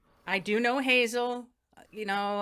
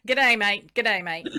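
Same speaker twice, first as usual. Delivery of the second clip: playful Aussie greeting; Australian accent; 2 semitones lower, 5 dB louder, slower than usual. Acted. Interviewer repeats her party piece, laughing Australian accent. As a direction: toss out a mock Australian hello, amused. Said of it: "it meant something in Australian".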